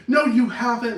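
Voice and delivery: loud retort. Retold or imitated. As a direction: snap back at the partner. Arguing back in the quarrel.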